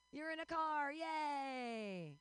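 high, drawn-out yay; playful high-pitched whoop; mock cheer. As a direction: cheer them on, stretching the vowels. Cheering at someone passing in car.

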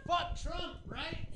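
quiet confidential agreement between friends; conspiratorial aside. Acted. Both sides privately agree, hushed.

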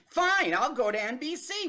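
half-hearted sulky comeback. Retold or imitated. Acted reply in quarrel.

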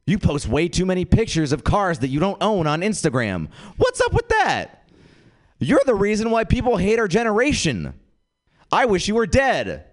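high-pitched mock outburst; overblown angry comeback. Comic retorts to Brad at a party, overblown anger. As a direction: build into a high, indignant rant.

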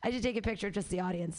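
ordinary talk

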